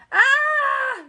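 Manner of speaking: high shrill witch shriek. Acted. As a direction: shriek as the water hits. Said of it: "shrieked the witch".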